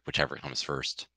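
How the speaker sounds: normal talking voice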